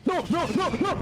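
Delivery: high panicked protest. Real, not acted. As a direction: cry out no in panic. Panicking as attacked.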